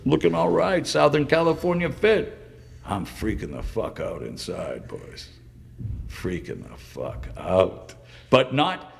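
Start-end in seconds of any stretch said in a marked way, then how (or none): 2.8-7.9 low, soft, almost under the breath; hushed confession. Real, not acted. Confessing the anxiety hidden inside, hushed confession. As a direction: drop right down to confide it, slowing on the repeat. Confides anxiety behind cool look.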